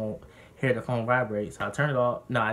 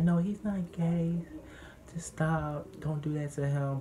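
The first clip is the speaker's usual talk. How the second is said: imitated grandma in denial; 5 semitones higher, slower than usual. Retold grandma denying it.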